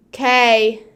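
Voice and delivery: sudden loud "Okay". Real, not acted. Streamer being loud for no reason, loud. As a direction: blurt "Okay" out at volume. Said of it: "I just like to be loud".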